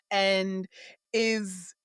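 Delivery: usual speaking voice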